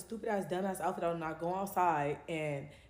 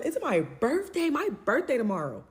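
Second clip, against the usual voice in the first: high, loud and stretched, hitting birthday; loud outraged protest; indignant outburst; 8 semitones higher, 6 dB louder than usual. Retold or imitated. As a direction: cry out that it's your birthday in disbelief.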